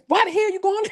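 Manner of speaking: high-pitched mock disbelief; incredulous outburst. Panel friends question her going, mock incredulous. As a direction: blurt it out high, imitating shocked friends.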